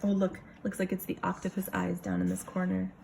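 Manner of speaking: ordinary delivery, nothing marked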